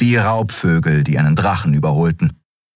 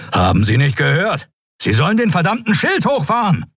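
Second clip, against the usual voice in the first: much higher, angry shouted demand; furious order; 12 semitones higher than usual. A narrator's reading. Admiral furious at the pilot ignoring his order. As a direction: rage at the pilot who ignores him.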